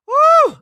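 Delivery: high, loud "woo"; loud exasperated whoop. Real, not acted. Venting to viewers about being too mad, loud high whoop.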